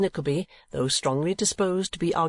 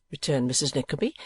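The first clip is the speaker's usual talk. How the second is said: ordinary delivery, nothing marked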